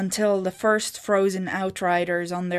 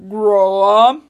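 interrupting; 11 dB louder than usual. A narrator's reading. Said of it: "Ogmund interrupted".

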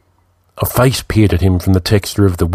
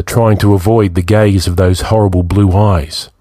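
ordinary delivery, nothing marked

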